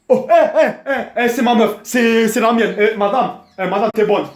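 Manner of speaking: mock catcall; street catcaller. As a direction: hail a passing woman like a guy in the street. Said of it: "un mec va dire, euh, dans la rue".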